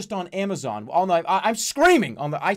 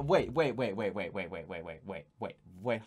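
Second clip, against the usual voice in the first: waits sinking lower and much softer; fading waits; 11 semitones lower, 9 dB quieter than usual. Real, not acted. To himself, seeing the stream froze, fading into silence. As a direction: trail off as it dawns on you.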